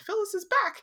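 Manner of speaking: high, loud happy shout; excited scream. Retold or imitated. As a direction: squeal it with delight. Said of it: "which I was screamed".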